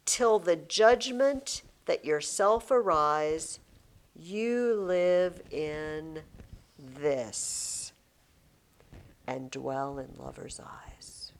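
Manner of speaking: slow, fading to a whisper; dramatic recitation. Shown as a demonstration. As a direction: let the line sink into a hush. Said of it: "reciting couplet".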